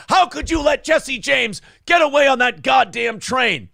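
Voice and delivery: high, loud indignant yell; mock outrage. Voicing angry viewers over the getaway, mock outrage. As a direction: holler it like the indignant crowd. Mimics the outraged crowd's reaction.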